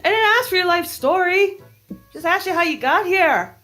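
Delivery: high, bossy little sailor; tiny mouse voice. Acted. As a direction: bark orders like a tiny officer. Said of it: "in his tiny little voice".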